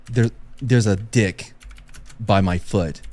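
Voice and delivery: low, slow and halting; retold stunned disbelief. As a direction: haltingly report a shocking find. Retold stunned sergeant finds body part.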